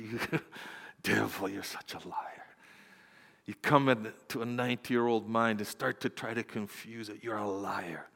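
low, very soft reproach; hushed rebuke. Retold or imitated. Preacher addressing the devil over confused elderly woman, quiet reproach. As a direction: rebuke him quietly, under your voice.

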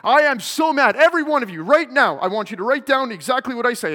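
loud, high furious order; angry command. Retold or imitated. Teacher ordering the class to take down his rant, angry shout. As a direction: demand it at full volume.

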